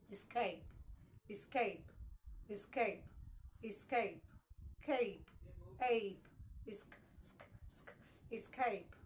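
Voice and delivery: escape repeated, then peeled down to esc; escape broken into sounds. Shown as a demonstration. Teacher drawing attention to the underlined sound, escape broken down. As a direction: drill escape word by word, isolating the SK. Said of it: "the sound I need you to pay attention to".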